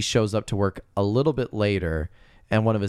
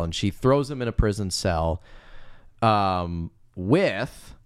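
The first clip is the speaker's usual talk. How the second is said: ordinary talk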